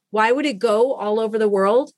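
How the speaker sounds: ordinary delivery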